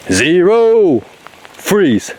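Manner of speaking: loud, high call at the dog; mock command to freeze; playful shout. Real, not acted. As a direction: bark out the end of the countdown. Countdown ends in dog game.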